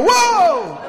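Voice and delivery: high drawn-out mock cry of alarm; long, high-pitched "whoa"; startled enemy. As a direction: act out the enemy reeling back. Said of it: "the enemy's gonna go".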